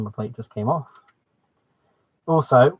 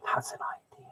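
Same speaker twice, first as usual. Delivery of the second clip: whisper; 12 dB quieter than usual. Real, not acted. Muses aside to himself.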